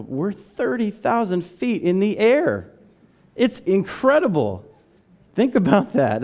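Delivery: preacher reawakening wonder at flying, amazed; high, emphatic burst of wonder. Shown as a demonstration. Preacher to congregation about flying, amazed. Contrasts jaded flyers with amazement.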